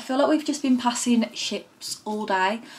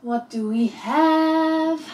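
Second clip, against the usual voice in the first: playful; 7 semitones higher, 4 dB louder, much slower than usual. Real, not acted. Playful sing-song question.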